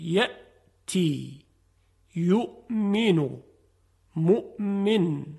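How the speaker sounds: یأتی، یؤمنو، مؤمن each very slow with a crisp glottal stop; slow hamza demo. Shown as a demonstration. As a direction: model the hamza word by word, slowly. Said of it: "همزه تلفظ نمیشه".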